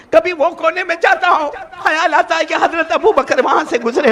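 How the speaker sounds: tearful confession. Acted. Abu Hanifa tells Imam Malik through tears why he must leave. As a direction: voice rising, close to sobbing. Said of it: "نے روتے روتے کہا".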